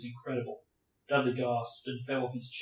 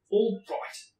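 higher, louder all right; louder insistent; 9 semitones higher, 6 dB louder than usual. Acted. Harry giving in to his uncle, higher and louder. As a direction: push the second all right up.

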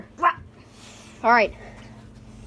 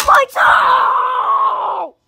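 mock battle shout; 7 semitones higher, 7 dB louder than usual. Shouting at enemy in game.

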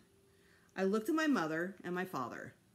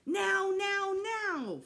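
put-on high, loud child's scream; screaming tantrum; 13 semitones higher, 6 dB louder, much slower than usual. A narrator's reading. Fudge screaming at Dad to see the baby. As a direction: shriek the demand. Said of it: "Fudge screamed".

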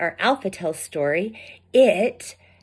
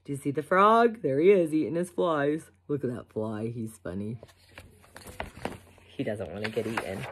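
hushed aside; 6 semitones lower, 7 dB quieter, much faster than usual. Real, not acted. Teacher's aside about the frog.

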